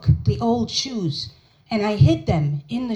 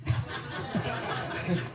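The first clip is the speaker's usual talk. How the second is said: breathy laugh; 13 dB quieter than usual. Real, not acted. After punchline.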